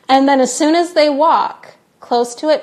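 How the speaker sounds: normal talking voice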